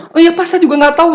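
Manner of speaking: high, loud and fast; excited rush. Retold or imitated. Student excusing herself to lecturer, excited rush.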